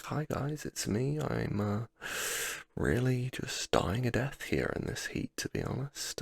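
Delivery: mock yourself freezing up for the camera; high-voiced, hesitant hello to the lens; camera-shy greeting. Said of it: "looks like I'd never seen a camera before".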